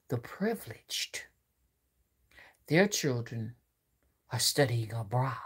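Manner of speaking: whispered aside. Real, not acted. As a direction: hiss the word like a secret. Hushed aside.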